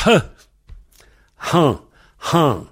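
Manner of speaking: "Han" with an audible puff of air; H soufflé. Shown as a demonstration. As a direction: blow out the H of "Han". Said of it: "la feuille doit bouger". (« Han » avec un H bien soufflé)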